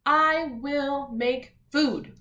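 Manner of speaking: deliberate group-reading pace; read together. Shown as a demonstration. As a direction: spell out the sentence word by word for children. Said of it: "Let's read them all together".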